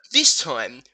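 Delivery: ordinary delivery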